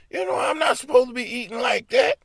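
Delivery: a shrill squawk of a complaint; mock shout. Wife complaining in the car.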